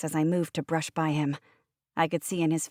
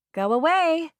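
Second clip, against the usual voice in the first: loud, high, sharp dismissal; angry shout at Teddy; shouted over shoulder; 11 semitones higher, 6 dB louder than usual. Acted. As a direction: throw it back as you storm out. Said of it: "I threw the words over my shoulder".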